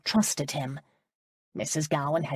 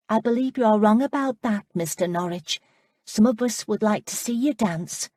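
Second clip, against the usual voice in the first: put-on higher, lighter voice for the girl Amanda; bright young girl pressing a question; young woman's voice; 5 semitones higher, 4 dB louder than usual. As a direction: play the eager young lass, pitched up. Amanda's voice, narrated scene.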